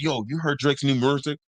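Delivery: imitating Memphis "music", said murzic; Memphis pronunciation. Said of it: "Imagine me calling and be like".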